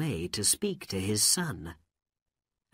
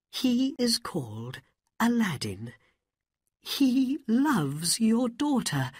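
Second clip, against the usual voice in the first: humble pleading mother; 9 semitones higher than usual. Acted. Mother pleading to the Sultan for her lovesick son. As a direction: beg the king gently for your son.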